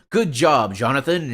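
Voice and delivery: loud, hammed-up captain getting the name wrong; captain's praise. Captain congratulating Benethan, loud mock praise. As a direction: boom it out like a hearty captain. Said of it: "he would be like".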